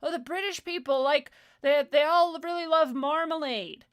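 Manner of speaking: high, loud, silly generalizer; mock stupid voice. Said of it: "listen to how stupid you sound".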